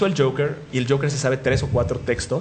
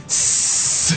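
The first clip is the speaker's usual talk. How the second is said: a long unvoiced sss; crowd hiss. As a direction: hiss like a jeering audience. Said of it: "quiero que griten".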